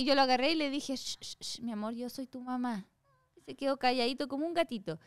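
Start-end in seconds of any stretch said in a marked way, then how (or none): 0.9-2.8 put-on soft hushing whisper to a baby; soothing shush. Mother calming her crying newborn, soft hush.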